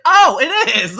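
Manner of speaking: loud, high sudden realization; surprised. Retold or imitated. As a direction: burst out with discovery. Retelling own sudden realization.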